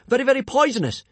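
high, loud frantic insistence; insistent shout. A narrator's reading. Hiccup insisting to the monster, frantic high shout. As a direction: yell it to scare the predator off. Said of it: "Hiccup shouted out".

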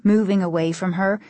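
ordinary delivery, nothing marked

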